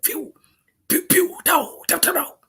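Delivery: high, loud imitated gunshots pew and dow; gunfire sounds. Veteran recalling gunfire on the way back, imitating shots. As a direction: mimic the bullets flying past. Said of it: "hear the gun go like that".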